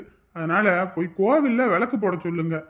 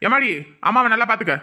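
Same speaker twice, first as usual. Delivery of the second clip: ordinary delivery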